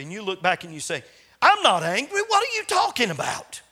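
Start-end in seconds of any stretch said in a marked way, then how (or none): 1.4-3.6 loud, much higher indignant snap; defensive denial. Pretend. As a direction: bristle and deny it, voice jumping up. Parent snaps back, denying anger.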